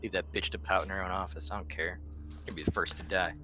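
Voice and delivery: ordinary talk, nothing marked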